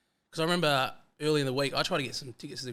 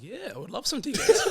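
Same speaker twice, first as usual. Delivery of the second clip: eager laughing yes; 11 semitones higher, 4 dB louder than usual. Retold or imitated. Eager reply retold, laughing.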